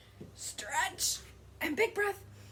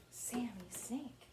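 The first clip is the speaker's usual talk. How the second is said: whispered name; 11 dB quieter than usual. Shown as a demonstration. Whispers name after hissing.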